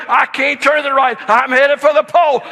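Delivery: high and loud wail; panicked kid. Retold or imitated. Daughter yelling back to dad from the bike, panicked wail. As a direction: cry it out helplessly.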